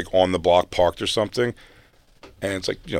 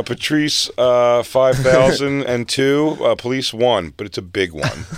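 mock announcer; 5 semitones higher, 7 dB louder, slower than usual. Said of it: "He goes, uh".

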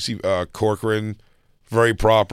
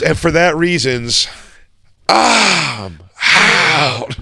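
slow, high, drawn-out mock verdict; milk the TV catchphrase, loud and theatrical; catchphrase imitation; 6 semitones higher, 4 dB louder, much slower than usual. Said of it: "When he does the "I'm out".

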